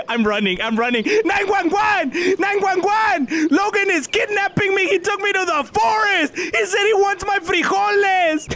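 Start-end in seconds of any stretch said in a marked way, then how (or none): 1.2-8.5 high frantic yell for help; panicked screaming. Acted. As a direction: scream it while running away. Screaming for help while running.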